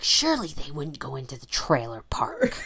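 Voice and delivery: whispered doubt, unvoiced and hushed; doubtful whisper. Mother doubting herself while searching, whispered. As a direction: breathe it as a private doubt.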